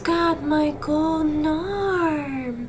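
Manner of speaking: very slow, drawn-out haunting call, rising in pitch; ghostly woman's wail. Acted. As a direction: wail it like a ghost, stretching every word. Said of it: "a woman's voice".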